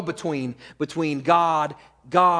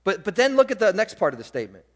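ordinary talk, nothing marked

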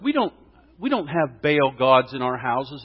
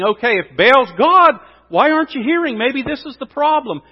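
high, raised mocking challenge; 8 semitones higher, 6 dB louder than usual. Elijah challenging the prophets about Baal's silence, mocking. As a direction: taunt with pointed, pitched-up questions. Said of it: "Elijah is mocking their false god".

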